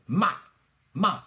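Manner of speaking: MA short and loud, falling from high; fourth tone. Shown as a demonstration. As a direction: chop MA down like a karate chop. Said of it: "the fourth one is".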